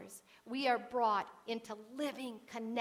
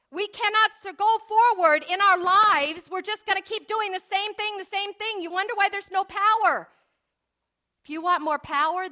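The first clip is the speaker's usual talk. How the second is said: loud, high revival preaching; fervent preaching; 8 semitones higher, 11 dB louder than usual. Acted. Preacher exhorts brothers and sisters, loud fervent preaching. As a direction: hammer home the weapons of faith.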